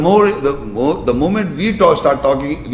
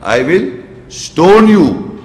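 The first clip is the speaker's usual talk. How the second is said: slow retold threat, higher and louder; angry father; 5 semitones higher, 5 dB louder, much slower than usual. Father threatening his son, mock-angry and drawn out. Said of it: "when he gets so angry".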